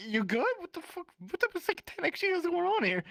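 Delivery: high-pitched mock disbelief; baffled questioning. Player trying to talk to his chanting friend, baffled.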